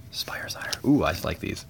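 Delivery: hushed, admiring whisper; soft unvoiced murmur over the cards. Real, not acted. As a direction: breathe the words out in awe. Quietly admiring new cards.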